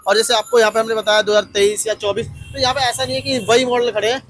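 loud, upbeat showroom promo; promo outro. Acted. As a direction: push the showroom to viewers. Loud wrap-up promo to viewers.

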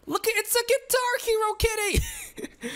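high excited call; delighted shout. Real, not acted. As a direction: point it out thrilled. Spotting a funny kitty.

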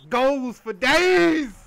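slow drawn-out cheer. Real, not acted.

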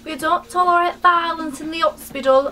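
lightly put-on British nurse telling her off; British accent. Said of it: "I think she was British".

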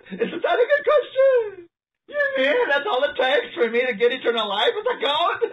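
high, squealing laugh through the words; laughing incredulity. Real, not acted. Preacher delights in the goat question, laughing incredulously. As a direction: crack up while asking, pitch way up.

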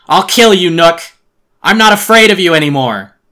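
high, full-force threatening yell; defiant shout. Acted. Boy with an axe facing his arch nemesis, defiant shout. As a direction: shout it in rage at an enemy you no longer fear.

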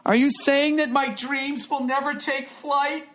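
squeaky offended kiwi; mock hurt kiwi. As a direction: play a sad little bird.

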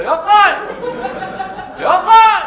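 very high, very loud call; shouting. Acted. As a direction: holler up to a friend's window. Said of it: "צעקתי לו".

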